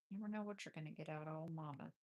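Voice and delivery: ordinary talk, nothing marked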